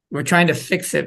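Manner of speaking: normal talking voice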